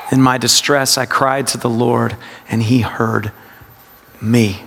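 pause, then ME alone and low; low meditative reading, isolated ME at the end; stress on ME. Shown as a demonstration. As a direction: hold back "me" and set it apart. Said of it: "it's a practice of word emphasis".

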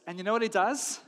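ordinary talk

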